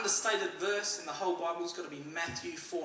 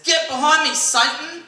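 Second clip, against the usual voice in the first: sudden loud, high-pitched outburst; shouted rebuke; 8 semitones higher, 13 dB louder than usual. Acted. Churchgoer rebuking man who pushed in queue, shouting. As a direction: bellow it like casting out the devil.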